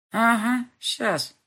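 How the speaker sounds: sarcastic muttered "Ага, щас"; sarcastic grumble. A narrator's reading. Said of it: "буркнула я язвительно".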